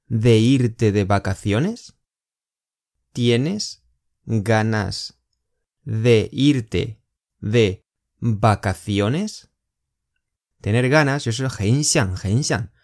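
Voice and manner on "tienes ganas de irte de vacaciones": very slow, chunk by chunk; slow split question. Shown as a demonstration. Teacher to students, slow chunked question. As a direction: lay out each piece slowly for learners. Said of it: "teacher splits question slowly".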